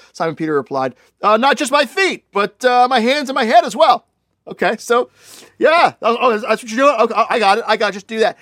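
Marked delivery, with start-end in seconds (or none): 1.2-4.1 high, loud, all-in enthusiasm; overeager Peter. Acted. Peter overeager, wants full washing. 5.6-8.4 high, loud eager "oh, I get it"; sudden realization. Acted. Peter finally gets it.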